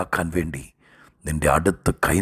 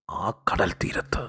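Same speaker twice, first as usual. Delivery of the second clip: slow, hushed sermon whisper; whispered pause in the preaching; dramatic whisper; 7 dB quieter, slower than usual. Real, not acted. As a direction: drop to a slow whisper for effect.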